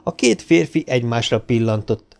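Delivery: usual speaking voice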